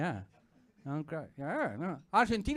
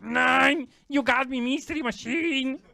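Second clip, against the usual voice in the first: Adolf Hitler; 7 semitones higher, slower than usual. Acted. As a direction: admit defeat as a caught villain. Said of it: "you peel the mask and it's Adolf".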